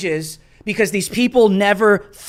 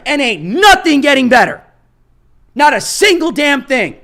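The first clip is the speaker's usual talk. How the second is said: heated shout; 5 semitones higher, 4 dB louder than usual. Real, not acted.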